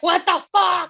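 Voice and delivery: high, loud "what the fuck" at the bill; shocked outburst. Retold or imitated. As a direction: blurt it out in disbelief at the bill. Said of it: "you're like".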